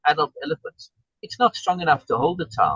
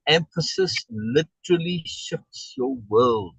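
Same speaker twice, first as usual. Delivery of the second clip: ordinary talk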